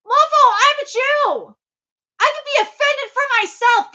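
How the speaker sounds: angry. Real, not acted. Ranting about offence on his behalf.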